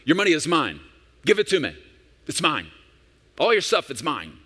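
loud high grabbing shout, mocking a greedy God; possessive demanding God. Pastor mocks a possessive God demanding your money, high loud shout. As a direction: bark it like a greedy landlord claiming everything. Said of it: "who comes to you and says".

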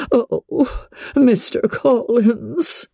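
high, drawn-out mournful sigh; doleful. A narrator's reading. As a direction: moan his name in sorrow. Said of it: "In a doleful voice".

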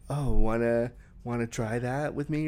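soft breathy flirty invite. Acted. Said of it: "move into your breathy voice".

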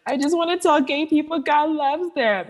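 high, tearful blurted confession; tearful cry. Retold or imitated. Telling her coach her calling while bawling, tearful. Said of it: "And I started bawling".

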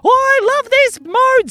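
very high, loud, stretched cartoon squeal; gleeful. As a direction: revel in it, slow and high. Tiger Pal revels in zigzagging.

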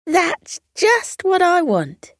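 gleeful and eager; delighted. A narrator's reading. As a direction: Coco thrilled at the pancake stall. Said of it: "called out in joy".

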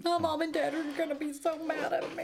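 high, hammed-up teenage whine; mock whining. Quoting an improvised movie line, mock whining.

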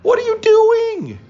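very high, loud, disbelieving cry of "what are you doing"; hammed-up exasperation at a teammate; incredulous shout. Exasperated teammates at the triple play.

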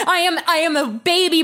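usual speaking voice

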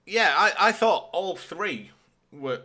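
usual speaking voice

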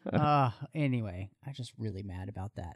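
usual speaking voice

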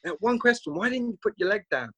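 high, hammed-up American voice; over-the-top American. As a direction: put on an exaggerated American accent, pitched well up. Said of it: "really over the top American".

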